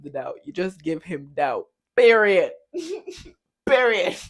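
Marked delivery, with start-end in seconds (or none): 2.0-4.2 loud, clipped final word with a laugh between; loud laughing emphatic period. Real, not acted. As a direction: slam it down twice.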